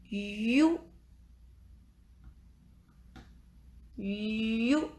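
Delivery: "you" with a long held opening glide; slow, careful "you", first sound prolonged; drawn-out dictation. Shown as a demonstration. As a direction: stretch the start of "you" for pupils. Dictating a syllable for pupils.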